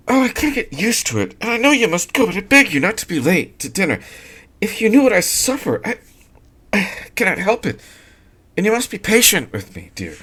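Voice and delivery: the wife begs her husband not to be late; anguished young wife. A narrator's reading. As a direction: beg through the fear. Sylvia's voice held.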